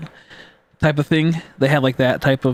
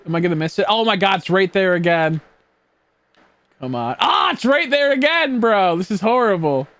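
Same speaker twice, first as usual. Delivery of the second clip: higher, louder frantic gamer; panicking gamer; 5 semitones higher, 3 dB louder than usual. Real, not acted. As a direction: panic as the target slips away. Missing the shiny in the game.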